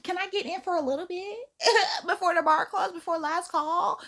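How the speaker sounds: high, coy flirting with a bouncer; flirty voice. Retold or imitated. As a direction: sweet-talk your way past the door. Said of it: "I'm about to bat my eyes to the bouncer".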